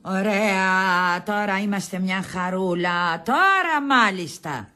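very slow, drawn-out sarcasm; heavy, dragged-out irony; sarcastic dismay. Acted. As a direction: drawl it as mock satisfaction. Sarcastic response to bad news.

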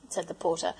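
ordinary delivery

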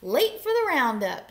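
high, loud cowboy-duck holler; yell. A narrator's reading. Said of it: "yelled Duck".

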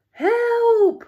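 very high, loud, drawn-out call; acted storybook cry for help; shout for help. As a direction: holler for rescue, hammed up. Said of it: "shouted the bear".

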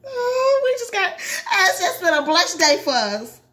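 high-pitched, drawn-out delight; emotional gushing. Real, not acted. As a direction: gush slowly as if overcome with joy. Reader gushes over the ending.

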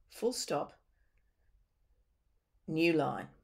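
spoken as a voice command to the computer; dictation command. Shown as a demonstration. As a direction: dictate the punctuation clearly. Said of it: "I'm also gonna say some things that seem a bit odd".

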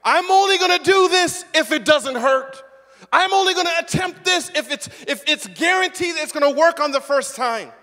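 loud, high, fussy demanding; mock cautious adult. Preacher mocks an adult who wants guarantees, loud and high. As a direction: whine out the conditions. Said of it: "approach an opportunity like".

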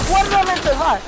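loud, high baffled question; baffled shout. Real, not acted. Gamer landing in the wrong spot, loud baffled question. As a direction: ask it in loud disbelief.

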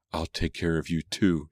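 ordinary delivery, nothing marked

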